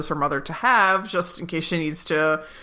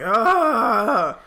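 disgusted groan; 5 semitones higher, 4 dB louder than usual. Retold or imitated. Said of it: "Eleanor's just like".

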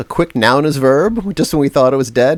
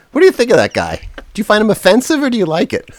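ordinary talk

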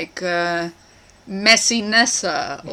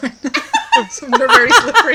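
laughing; 11 semitones higher, 4 dB louder than usual. Real, not acted.

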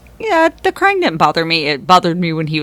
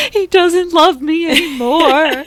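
mock sad; 11 semitones higher, 4 dB louder than usual. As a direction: playfully fake heartbroken. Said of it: "the other part of me was like".

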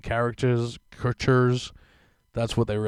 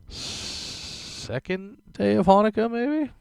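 high, slow, questioning sing-song; unsure drawl; 11 semitones higher, much slower than usual. Real, not acted. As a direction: stretch it out, unsure of the date. Unsure holiday greeting, drawn out.

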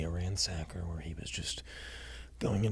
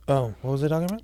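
higher, fast, dazed; groggy just woke up; 5 semitones higher, much faster than usual. Acted. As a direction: snap awake and ramble. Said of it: "you just dozed off there".

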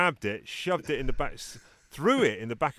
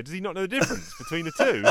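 laughing disbelief; 10 semitones higher, 3 dB louder, faster than usual. Retold or imitated. Incredulous quote, laughing.

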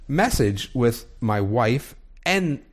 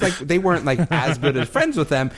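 usual speaking voice

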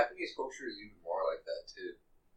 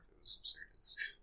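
whispering; 14 dB quieter than usual. Real, not acted. Quiet aside in discussion.

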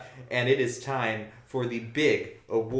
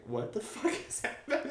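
baffled; 11 semitones higher, 7 dB quieter than usual. Retold or imitated. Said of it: "sitting in my seat the whole time going".

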